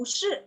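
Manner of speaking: firm downward "shi"; falling-tone SHI. Shown as a demonstration. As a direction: drop "shi" after the rise. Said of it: "shi-nya tetap turun".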